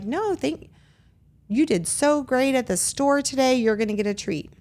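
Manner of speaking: put-on high, bright praise to a child; upbeat praise. Parent praising child after a good store trip, high and excited. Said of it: "but we're gonna be like".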